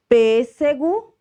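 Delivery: Pêssego said slow, loud and high, closed pê; emphatic model word. Shown as a demonstration. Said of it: "usamos o acento circunflexo".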